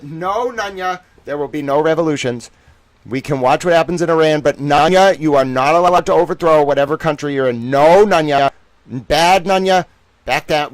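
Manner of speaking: loud, mock-stern telling-off of a naughty pet; mock scolding. Streamer scolding chat member Nunya, loud mock-stern. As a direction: scold him like a dog caught chewing shoes.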